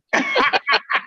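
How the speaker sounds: real high laugh; high-pitched laugh. Real, not acted. Laughing at ten years of saying it wrong, high laughter.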